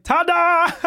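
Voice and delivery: high, loud ta-da; mock ta-da. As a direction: pop out with a big surprise. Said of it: "He's like".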